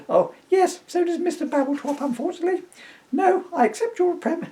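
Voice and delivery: put-on high, lightly fussy old lady voice; the lady correcting herself at the counter. Lady voice continued.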